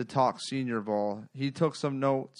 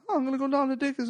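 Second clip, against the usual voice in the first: much higher-pitched buddy voice; imitating friend; 13 semitones higher, much faster than usual. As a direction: mimic a friend's reply up high. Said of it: "And he goes".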